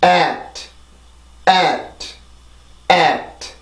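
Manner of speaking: "at" with pressed æ and released t; isolated "at", falling vowel then a light t; adding final T. Shown as a demonstration. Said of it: "T 발음이 들어가요".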